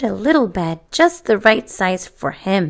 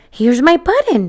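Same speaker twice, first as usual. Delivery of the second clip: glad cry; 6 semitones higher than usual. A narrator's reading. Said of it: "he cried".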